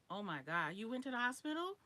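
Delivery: real shock, rising high on the question. Real, not acted. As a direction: gasp out a loud, disbelieving question. Learns of hospital visit on call.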